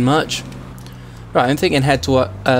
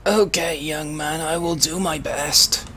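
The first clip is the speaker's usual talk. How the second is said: put-on slow, higher, softer frail old gent; a doddery old Briton protesting politely; old man voice; 3 semitones higher, 5 dB quieter, slower than usual. Old man voice denying to the officer.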